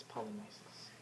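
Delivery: Polynices said pol-ee-NYE-siz, soft and whispered; correct Polynices. Shown as a demonstration. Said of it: "say your brother's name right".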